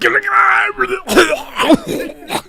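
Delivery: high strangled wail; hammed-up dying shout; mock death cry. Acting Caesar's death.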